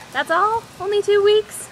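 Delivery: mock disbelief. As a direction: act outraged that it's so soon. Mock shock at competition date.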